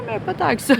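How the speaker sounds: squeaky high laugh breaking into the words. Real, not acted. To the host about her school years, high laughing. Laughs at own age joke.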